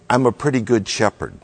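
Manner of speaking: quick, throwaway "I'm a pretty good shepherd" with no weight on "good"; offhand, casual. Shown as a demonstration. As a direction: toss it off fast like a shrug. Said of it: "Jesus is not saying".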